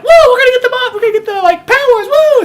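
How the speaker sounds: very high, loud cartoon alien whoop; giddy aliens plotting to steal powers; goofy alien. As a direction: cheer like a silly cartoon villain. Silly alien villains cheering.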